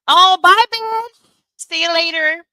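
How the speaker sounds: loud high sassy farewell; sassy goodbye. Real, not acted. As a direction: wave them off loudly. Mock farewell to troll.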